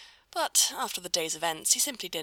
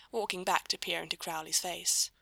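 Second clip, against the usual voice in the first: usual speaking voice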